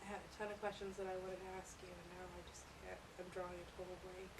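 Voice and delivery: hushed, nervous whisper to the dark; jumpy whispering ghost hunt. Real, not acted. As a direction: whisper questions to a spirit. Hushed EVP session in back room.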